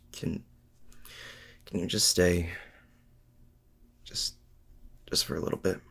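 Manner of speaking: soft, halting plea to stay; small, unsure, hushed request; hesitant plea. Acted. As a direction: ask quietly, trailing into a near-whispered thanks.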